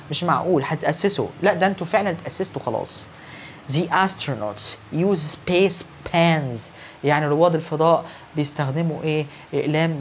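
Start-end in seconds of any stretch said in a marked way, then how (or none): 3.7-6.6 every word stretched far apart for note-taking; slow dictation. Shown as a demonstration. Teacher dictating the third sentence to students, very slowly. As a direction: read it out very slowly for copying. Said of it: "The last one here".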